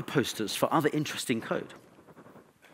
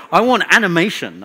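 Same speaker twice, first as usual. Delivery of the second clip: emphatic; 5 semitones higher, 12 dB louder than usual. Real, not acted. Passionate demand in talk.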